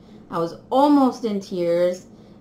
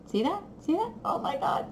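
awed whisper; 9 semitones higher, 7 dB quieter than usual. Real, not acted.

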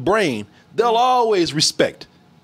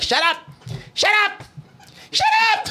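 "shut up" climbing higher each time; escalating high-pitched command, rising to a squeal; father's repeated order; 12 semitones higher than usual. Retold or imitated. Father cutting off his son, escalating. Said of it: "the whole time, like".

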